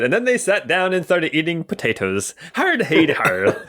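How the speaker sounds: a quick mock Irish joke, half laughing; mock Irish accent. Joking about the Irish story, in a hammed-up Irish accent. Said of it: "I said".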